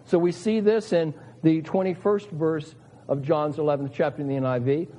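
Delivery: normal talking voice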